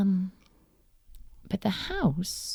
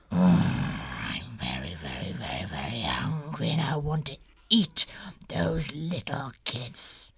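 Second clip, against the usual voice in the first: low hungry wolf; 11 semitones lower than usual. Acted. Wolf's hungry thought.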